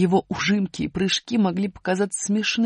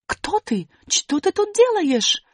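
высокий детский голос, напористо и медленно; девочка засыпает сторожа вопросами; напористые вопросы; 12 semitones higher, 3 dB louder, slower than usual. Acted. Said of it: "накинулась на него с вопросами". (высокий напористый голос девочки)

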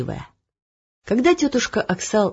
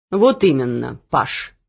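a pompous king, lower, slow and musing; the puzzled king reasoning aloud; lightly voiced king; 6 semitones lower than usual. (король)

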